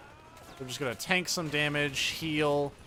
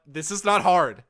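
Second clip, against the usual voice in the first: loud and exasperated; frustrated outburst; 4 semitones higher, 11 dB louder, slower than usual. Real, not acted. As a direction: vent loudly at yourself. Frustrated at failing an easy section.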